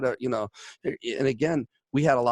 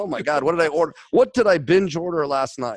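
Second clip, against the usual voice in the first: louder, raised mock exclaim of dismay; 4 semitones higher, 6 dB louder than usual. Shopper facing piles of boxes, louder mock dismay. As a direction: play up the shock at your own binge order.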